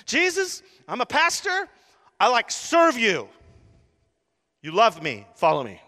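high and loud, pulling rank; hammed-up appeal for attention; mock pleading shout. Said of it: "But I would there".

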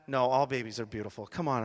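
ordinary delivery

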